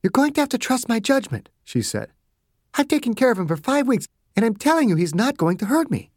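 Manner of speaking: doctor insisting she can handle the patient; firm Margaret. A narrator's reading. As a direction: hold your ground kindly.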